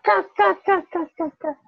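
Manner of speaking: ca sung up high, over and over; high repeated syllable. Shown as a demonstration. Tutor drilling the learner on ca, high and insistent. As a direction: lift ca way up and repeat it. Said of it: "repeating syllable to learner".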